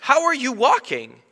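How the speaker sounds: marvelling at a healed man, high and loud; amazed. Retold or imitated. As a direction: gasp it out in astonishment. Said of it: "I'm like".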